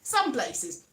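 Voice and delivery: ordinary delivery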